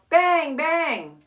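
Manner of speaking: ordinary talk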